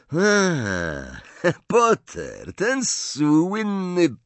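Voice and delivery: mocking sneer with a scoffing laugh; Malfoy. A narrator's reading. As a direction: draw out the name in scorn, swooping high. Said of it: "zadrwił Malfoy".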